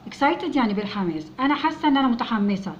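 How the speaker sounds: normal talking voice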